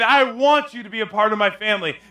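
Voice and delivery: high, loud preacher voicing God; God's urgent appeal. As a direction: proclaim it insistently as God's own plea. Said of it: "God's saying".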